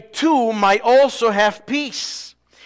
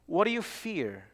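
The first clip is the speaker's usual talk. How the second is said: much softer, almost dropped away; hushed question; 13 dB quieter than usual. Acted. Preacher quietly repeats his question to the congregation. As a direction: ask it again quietly, drawing them in.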